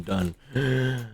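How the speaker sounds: ordinary talk, nothing marked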